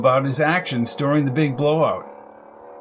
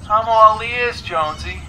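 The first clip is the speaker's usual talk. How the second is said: higher caller's voice on the line; voice on phone; 6 semitones higher than usual. A narrator's reading. Coco listens to Jones's clincher, higher phone voice. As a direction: invite him on in a higher phone voice.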